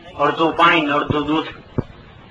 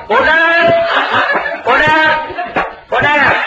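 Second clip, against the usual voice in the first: bang out order, order; calling order; 9 semitones higher, slower than usual. Retold or imitated. Magistrate calling order in court.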